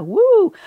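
high, drawn-out "woo"; playful whoop. Real, not acted. Host reacting to [name] in cast list, playful whoop. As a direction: give a loud, high little cheer.

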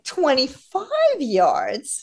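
high, incredulous retelling of shock; disbelief. Retold or imitated. Retelling own shocked reaction.

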